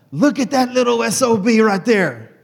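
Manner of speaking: loud, high, rough-loving grandpa holler; pawpaw's gruff praise. Retold or imitated. Grandfather proudly calling out his grandson to the workers, loud. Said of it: "he's saying it that way".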